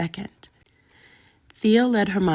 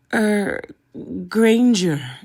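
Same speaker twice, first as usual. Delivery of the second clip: ordinary delivery, nothing marked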